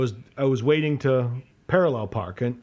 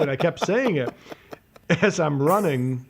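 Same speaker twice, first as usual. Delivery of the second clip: usual speaking voice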